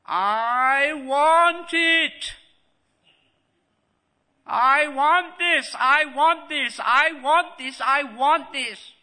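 loud, high, drawn-out tantrum wail; demanding child. Acted. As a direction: stretch every word like a greedy child demanding. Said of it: "when you were a little child ... you said".

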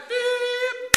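very high, loud sustained beep; imitated beep. Speaker imitating the knock-off signal, loud beep. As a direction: mimic a piercing time signal.

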